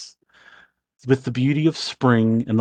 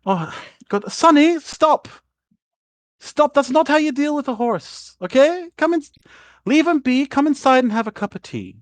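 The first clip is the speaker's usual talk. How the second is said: high-pitched, fussing mum voice; acted motherly scolding, pitched up; 12 semitones higher than usual. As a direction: chide him, then coax him inside. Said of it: "your mother go".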